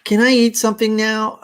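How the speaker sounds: high, loud demand; a kid cutting in; whiny hungry child. Acted. Child interrupts.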